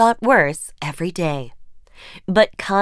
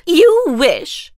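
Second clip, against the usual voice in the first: snide retort; 9 semitones higher, 5 dB louder than usual. Acted. Sister retorts.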